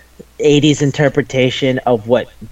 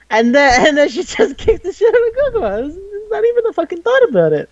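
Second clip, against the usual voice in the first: laughing while telling. Real, not acted. Laughing through the story.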